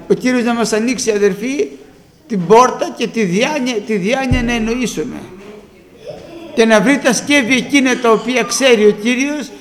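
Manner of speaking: usual speaking voice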